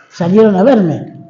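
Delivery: normal talking voice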